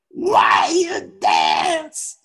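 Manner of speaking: slow, stretched-out mock shout; imitated yelling. Teacher repeats himself to silent dancers, imitated yelling. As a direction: drag out each word as a hotheaded teacher. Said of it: "He just kept yelling".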